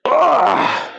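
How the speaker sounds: sharp high cry of effort; pained cry. Real, not acted. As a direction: let out a strained yelp. Cry at the hardest rep.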